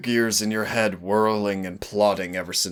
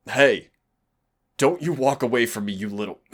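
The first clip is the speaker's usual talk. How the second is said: sharp, loud, higher call after someone leaving; angry shout; 4 semitones higher, 6 dB louder than usual. Acted. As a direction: yell at them not to walk away. Demon trapped, partner walks off.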